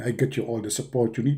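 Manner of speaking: deep, low whisper from above; Allah's voice. Acted. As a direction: whisper it like a hidden god. Allah speaks.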